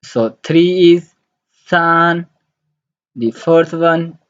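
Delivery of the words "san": San held high and level; first tone. Shown as a demonstration. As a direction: stretch San on one steady high note. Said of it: "the first tone".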